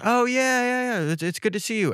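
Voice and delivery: put-on high, polite girl voice; mock awkward girl; imitating the date. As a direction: pitch up for the date's awkward reply.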